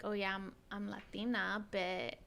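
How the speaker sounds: ordinary delivery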